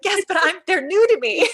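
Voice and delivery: high, loud protesting retort; high-pitched playful objection; mock protest. Comic retort to those ahead.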